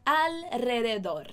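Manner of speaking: slow, clearly projected "Alrededor"; drawn-out, carefully articulated Spanish "Alrededor"; pronunciation drill. Shown as a demonstration. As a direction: model "Alrededor" slowly for learners to repeat. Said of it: "Repete comigo mais uma vez".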